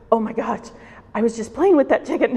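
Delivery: retold horrified protest, rising in pitch and volume; acting out a child's shock. Child shocked at grandmother killing chicken.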